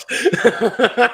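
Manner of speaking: a squealing high laugh breaking out; high burst of laughter. Real, not acted. Host's cannibal joke cracks her up, real high laugh.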